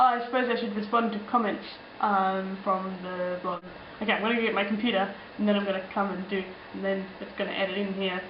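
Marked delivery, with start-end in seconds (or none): none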